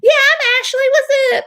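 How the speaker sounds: very high squeaky answer; high, silly chirp; mock-perky reply. Acting out her own chirpy reply.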